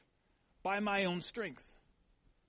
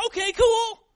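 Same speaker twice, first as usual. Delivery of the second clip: son's casual reply; 15 dB louder than usual. Retold or imitated. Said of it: "he's like".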